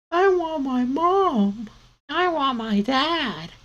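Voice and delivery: put-on very high, slow, loud child whine; slow high pleading for mom and dad; whimpering kids. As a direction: wail it like a lost little kid. Kids wail for their parents.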